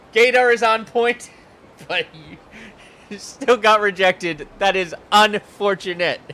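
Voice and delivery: high, loud laughing repeat; mock the line while laughing; giggling sarcastic echo; laughing mockery. Real, not acted.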